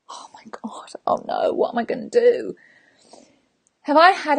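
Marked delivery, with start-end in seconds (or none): 0.1-2.6 gasp, then hushed fretting; mock dismay. Friend walking away without help to move, mock dismay. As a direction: fret breathily in mild mock panic.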